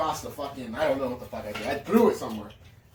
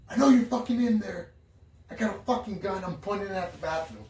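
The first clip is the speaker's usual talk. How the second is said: high, loud warning to the intruder; yelling at the locked bathroom door with a gun; threatening yell; 6 semitones higher, 3 dB louder than usual. Retold or imitated. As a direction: shout through the door like you mean it.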